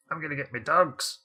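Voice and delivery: a hint of faux Scottish dwarf. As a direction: put on a mild dwarf voice. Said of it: "dwarves are often portrayed with the".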